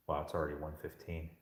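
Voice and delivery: usual speaking voice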